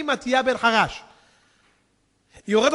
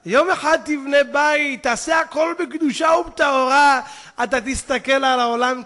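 slow, loud, high exhortation; fervent preaching; 5 semitones higher, 8 dB louder than usual. Real, not acted. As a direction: proclaim it with drawn-out fervor. Preaching at full force. (דרשה נלהבת, איטית ורמה)

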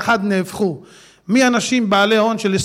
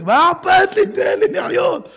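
carefree outburst; 9 semitones higher, slower than usual. Acted. Acting a carefree man.

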